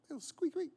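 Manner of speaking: high, thin squeaky noise; high-pitched mouse-like squeak; imitated squeak.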